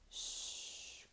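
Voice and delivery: softly hissed "ch"; just breathe out "ch"; hushed ch. Shown as a demonstration. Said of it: "le son ch".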